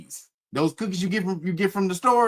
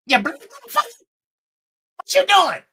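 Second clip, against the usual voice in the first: imitating mean old aunt; 8 semitones higher, 3 dB louder than usual. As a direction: bark it like the mean aunt yelling at her nephew. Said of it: "This is actually how she sounded".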